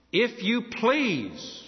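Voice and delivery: very slow, lifted "if you please"; slowed, tender please; slow, drawn-out please. Shown as a demonstration. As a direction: linger on each word. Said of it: "If you are willing".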